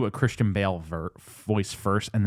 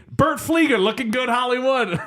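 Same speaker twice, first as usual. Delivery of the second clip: imitating Robin; 13 semitones higher, 4 dB louder than usual. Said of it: "but it being".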